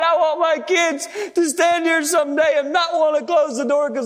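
high, fervent preacher's cry; heartfelt vow building from a high start; impassioned plea. Real, not acted. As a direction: plead earnestly with God, pitched high. Impassioned plea to God while preaching.

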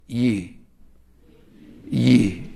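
"Ye" said Southern-style, lips not pushed out; Nashville "you". Shown as a demonstration. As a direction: say "Ye" with relaxed, unrounded lips. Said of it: "Here's how you say it".

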